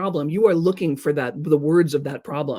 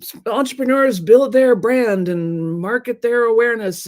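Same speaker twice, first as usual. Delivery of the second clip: pompous marketer; 6 semitones higher, 5 dB louder, slower than usual. Retold or imitated. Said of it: "They're like".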